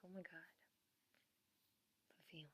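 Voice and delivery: very soft acted-out swoon; hushed overwhelmed reaction. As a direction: quote your awed reaction, barely audible. Acting out her overwhelmed reaction.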